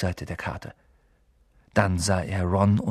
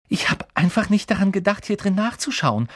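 much higher, louder, eager; excited Hermine; 9 semitones higher, 3 dB louder than usual. A narrator's reading. Hermine comes down with the old book, high and excited. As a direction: burst out eagerly. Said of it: "flüsterte sie erregt".

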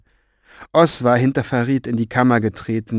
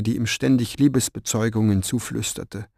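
usual speaking voice

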